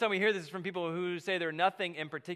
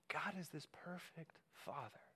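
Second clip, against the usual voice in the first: breathy whispered repeat; 14 dB quieter than usual. Acted. Preacher whispering Jesus' words to the congregation. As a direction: whisper it like you're leaning in close.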